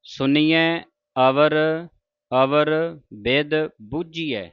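slow line with pauses between the words; spaced recitation. Shown as a demonstration. Teacher spaces out the line for students. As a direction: recite word by word, slowly. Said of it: "ਬਿਧ ਪਾਠ ਪੋਲਾ ਹੈ".

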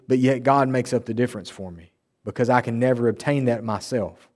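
ordinary delivery

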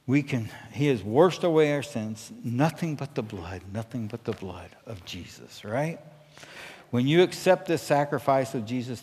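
ordinary talk

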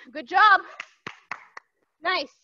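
ordinary delivery

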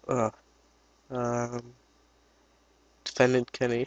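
ordinary delivery

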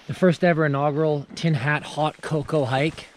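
normal talking voice

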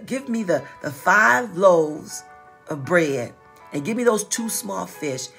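ordinary talk, nothing marked

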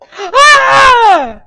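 sudden crying shout, high and very loud; full scream of terror; fright scream. Real, not acted. Screams as creature pops up.

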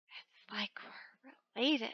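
slow, soft confiding whisper. Real, not acted. Player joking about a look-alike worm, slow whisper. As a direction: let them in on a little secret.

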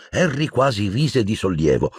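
ordinary delivery, nothing marked

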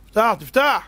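calling at door. Retold or imitated. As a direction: demand the door open now. Knocking at door at night. (קורא בקול ליד הדלת)

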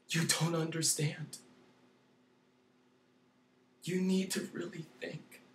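quiet pleading aside; hushed. Real, not acted. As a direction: drop to a hush after the rage. Sudden hush after screaming.

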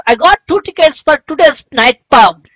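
ordinary talk, nothing marked

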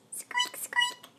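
very high, soft mouse squeaks; very high quiet squeak, squeak. Shown as a demonstration. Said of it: "Can you squeak like a mouse?".

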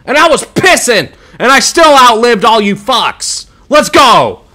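triumphant high shouting; loud, high victory gloat; gloating yell. Real, not acted. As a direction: crow over the whole lobby. Gloating after surviving the match.

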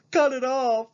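high desperate sob; tearful girl. Acted. Mallory panicking in bed.

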